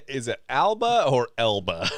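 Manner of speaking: Alba with an open A against Elba with an E; two pronunciations compared. Shown as a demonstration. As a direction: contrast the first vowels of "Alba or Elba". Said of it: "Am I saying Elba right?".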